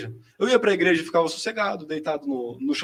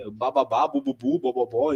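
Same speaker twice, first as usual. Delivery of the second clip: put-on baby babble; 5 semitones higher, slower than usual. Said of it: "só sílabas".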